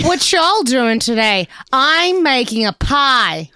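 put-on slow, drawn-out Southern-ish country accent; vague put-on accent. Joking as a neighbour offering pie, slow Southern-ish accent. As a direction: drag out the Southern-ish country accent, unsure which one. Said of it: "I don't know what accent that was".

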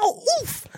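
high, drawn-out mock wail, then oof; imitated flop. Co-host imitating a guy flopping, high mock wail. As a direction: overdo a player's cry on being hit. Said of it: "then he was like".

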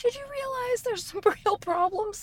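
high, sly car voice; talking car voice. Pretend. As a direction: speak as the car, pleading. Car voice, breaking into laughter.

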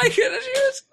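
high cracking squeal of embarrassment; flustered yelp. Real, not acted. Flustered outburst remembering the girl.

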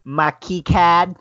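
hammed-up Boston accent, high, loud and drawn out. Cohost teasing the host with a showpiece phrase, exaggerated Boston accent. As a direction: stretch out a mock Boston "key card". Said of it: "my accent".

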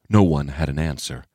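ordinary delivery

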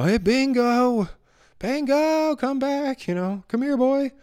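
high, drawn-out call for a lost dog; imitating a calling owner. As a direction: call the dog's name far up in pitch. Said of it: "hollering for the dog".

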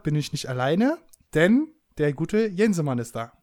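normal talking voice

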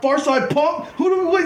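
high, hammed-up insult; imitating the taunting New Yorkers; mock tough taunt. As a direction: sneer it like a rival thug. New Yorkers insulting him, retold.

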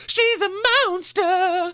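high squeal on monster. Acted. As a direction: screech the word monster. Calling her a monster.

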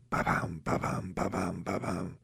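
soft, voiceless ba-bam thumps like a pounding heart; heartbeat sound. Retold or imitated. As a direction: breathe out a quiet racing heartbeat. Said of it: "my heart was like".

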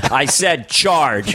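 slow, flat-out commanding drawl; John Wayne. Retold or imitated. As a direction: refuse to hear it, slowly. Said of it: "John Wayne".

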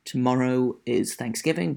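normal talking voice

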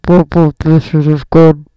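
very loud, low, mouth against the microphone; right up on the mic. Real, not acted. Testing the mic position for a co-host, far too close and loud. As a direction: blast it straight into the mic. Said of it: "people are not happy with you".

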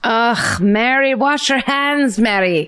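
exasperated scolding. Pretend. Scolding Mary the cook about washing hands. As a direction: scold her like a fed-up boss.

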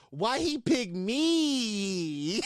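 long, drawn-out mocking whine; slow, dragged-out mimicry of a grown man's complaint; whiny Meek imitation. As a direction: stretch the last word out slowly, mocking him. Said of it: "I read like I thought because that's how he sounded".

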